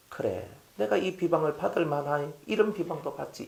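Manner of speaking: soft, self-effacing reply; resigned acceptance. Acted. Pastor models accepting slander from others, quietly. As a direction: take the criticism quietly, without protest.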